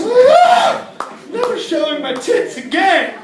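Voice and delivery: loud high startled shriek; startled woman. Pretend. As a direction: shriek it, caught off guard.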